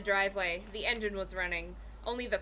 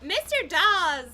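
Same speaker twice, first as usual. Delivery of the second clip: high, loud, slow exclamation; loud cry of recognition; exclaiming; 9 semitones higher, 7 dB louder, slower than usual. A narrator's reading. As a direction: exclaim the name in delight. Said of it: "Josh exclaimed".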